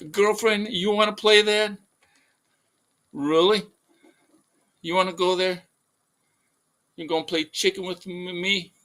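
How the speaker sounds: high-pitched mock sassy challenge; confrontational challenge. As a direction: throw down an incredulous, high-voiced dare. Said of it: "I'm like".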